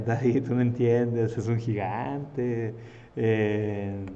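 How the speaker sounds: slow, drawn-out reluctant excuse; rey acobardado. Acted. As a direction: play a hesitant king dragging out his words. Acted reluctant king making excuses. (rey reacio, hablando lento)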